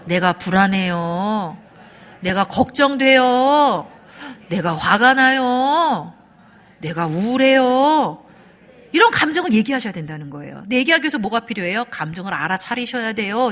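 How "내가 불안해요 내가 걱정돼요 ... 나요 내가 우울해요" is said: loud, very drawn-out words with a full stop between; emotion-naming drill. Shown as a demonstration. Speaker leading the audience in practice at naming feelings, loud and drawn-out. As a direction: call out each feeling slowly, stretching the ending. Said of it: "한번 연습해 볼까요".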